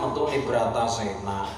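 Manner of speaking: usual speaking voice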